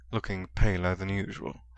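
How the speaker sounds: usual speaking voice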